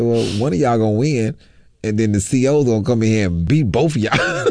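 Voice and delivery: jovial sarcasm. Retold or imitated. Prisoner teasing two men about to fight, jovially sarcastic. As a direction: needle them cheerfully, voice lifted, imitating your old self. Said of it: "so much sarcastically jovial things".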